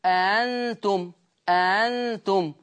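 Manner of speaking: very slow أنتم, opening hamzas eased into one long glide; eased second hamza. Shown as a demonstration. Teacher showing it is just like Hafs, slow eased أنتم. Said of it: "هي دي زيها بالظبط".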